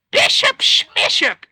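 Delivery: high, slow, mocking dismissal; wife scoffing at her husband's small ambition. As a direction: brush the idea off with scorn.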